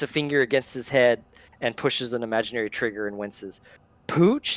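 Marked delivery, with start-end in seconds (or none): 4.1-4.5 high puzzled question; a hint of a confused old-timer; puzzled old guard. Hardro mishearing the conductor, puzzled. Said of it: "says Hardro, puzzled".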